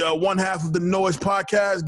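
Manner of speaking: normal talking voice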